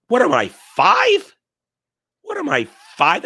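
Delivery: loud, high, incredulous protest; hammed-up outraged question; mock indignation. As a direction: exclaim it like you've been treated as a child. Mock outrage at soup suggestion.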